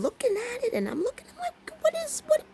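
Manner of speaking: climbing into a tiny squeak; baffled, very high squeal that fades out; high-pitched voice. Retold or imitated. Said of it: "I got my high-pitched voice going on".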